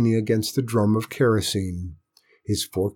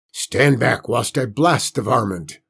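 uncle voice. A narrator's reading. Uncle Ben voiced, armed.

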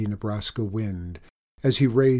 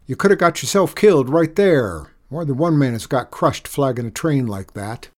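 loud, raised scolding; angry scolding; 4 semitones higher, 8 dB louder than usual. A narrator's reading. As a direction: scold him hard for the danger. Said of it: "the man bellowed".

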